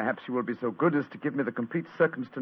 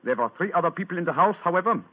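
put-on Holmes passing as the German count, a light German accent; lightly voiced German count; German count disguise. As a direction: keep up the German accent to fool his friends. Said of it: "I hope they won't recognize me".

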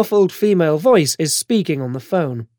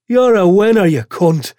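put-on drunken cheer; 5 semitones higher, 7 dB louder than usual. Drunk uncle cheering at celebration.

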